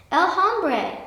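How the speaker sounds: the ant recognizes the man, exclaims; excited high exclamation; exclaiming. A narrator's reading. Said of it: "exclaimed the little red ant".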